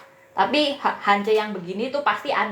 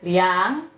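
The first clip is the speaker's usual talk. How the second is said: slow "liang" that dips and climbs; "liang" pushed down before it swings up; dipping third tone. Shown as a demonstration. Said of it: "Ini nada ketiga nih".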